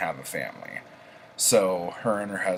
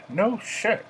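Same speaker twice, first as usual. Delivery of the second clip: background heckler. Acted. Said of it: "some guy says in the background".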